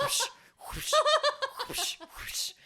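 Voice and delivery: breathy whooshing punch sounds; whoosh sound effects. Retold or imitated. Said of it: "my own punching noises".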